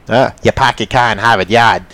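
put-on a lightly done Boston accent; a hint of Boston, dropping the r in car and Yard. Podcaster jokingly slips into a mild Boston accent. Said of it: "I don't do accents".

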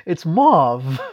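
"mauve" loud, plain vowel, firm v; exasperated correction. Shown as a demonstration. Co-host giving the last correction, insistent pronunciation. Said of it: "Stop saying that".

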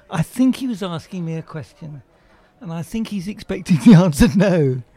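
ordinary delivery

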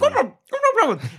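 high, squeaky excited gnome gibberish. Acted. Fat gnome excitedly babbles at lost travelers, squeaky high. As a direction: jump way up in pitch, thrilled gnome babble. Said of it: "excited expression on his face".

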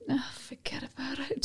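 breathy whispered shrug-off; whispered aside. Retold or imitated. Mum shrugging off shoplifted items, whispering. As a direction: whisper it like a guilty thought.

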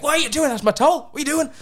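mock the stranger's outrage over her towel; imitating an outraged woman. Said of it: "This woman's like".

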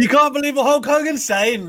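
shrill, high mock outrage; mock disbelief. Co-host mocks his partner's doubt about Hogan, high mock-shocked cry. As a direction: feign shock at the blasphemy.

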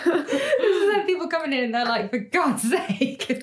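high, helpless laughter through the words; laughing. Real, not acted. Laughing after an emotional hour.

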